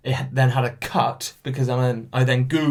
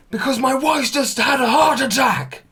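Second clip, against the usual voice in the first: high, loud shout right in someone's face; angry neighbour yelling at the door; furious shout; 12 semitones higher, 4 dB louder than usual. Retold or imitated. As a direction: yell it at him, outraged. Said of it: "Right into my face".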